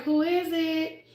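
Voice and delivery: high, slow, innocent who is it; coy playing dumb. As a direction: pretend you don't know who's knocking. Playing dumb at God's knock.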